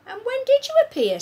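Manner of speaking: put-on high young girl voice; little girl voice. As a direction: speak as a small girl. Sophie voiced.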